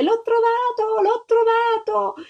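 put-on high, slow, thrilled child's cry; a little girl crying out in joy; joyful shout. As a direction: shout it out, giddy with delight. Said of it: "si mise a gridare".

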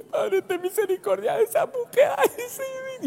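high, thin whimper; mocking a woman crying at a show; mock weepy woman. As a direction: whimper like an over-emotional viewer. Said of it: "Ven una serie de Netflix".

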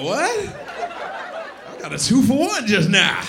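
high, slow amazed exclamation; surprised. Acted. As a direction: gasp it out in wonder. Amazed at a two-for-one fart.

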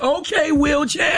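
high, slow, drawn-out call of a nickname; casual call-out. Retold or imitated. Radio host mocking how people call out to a stranger, high and drawn out. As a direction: sing out the nickname across the room. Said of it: "this is how we talk".

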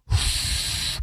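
an unvoiced puff of air blown out; frustrated exhale. Player who lost the challenge, a frustrated exhale. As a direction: blow out air in disgust. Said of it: "he blows, uh, air out, and he goes".

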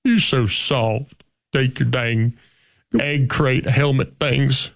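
hammed-up disgusted coach with a twang. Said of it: "impersonate offensive line coaches".

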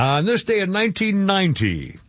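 usual speaking voice

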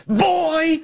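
high-pitched imitation of a man yelling; hammed-up high holler; mock yell. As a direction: shriek it in mockery. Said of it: "He's yelling".

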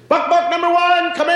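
put-on a kid shouting the game call. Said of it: "kids come running up and they say".

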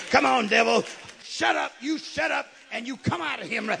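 loud, forceful exorcist's order; commanding shout. Retold or imitated. Jesus casts out the devil mid-service, commanding shout.

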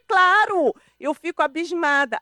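high and loud, appalled; scandalized "of course!"; outraged retort. Retold or imitated. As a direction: throw it back in indignation. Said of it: "Eu falei".

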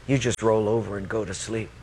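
low, level reproof; calm, grave divine rebuke; God. Retold or imitated. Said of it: "then God spoke to me".

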